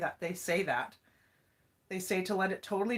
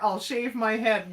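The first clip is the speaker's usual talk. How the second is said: normal talking voice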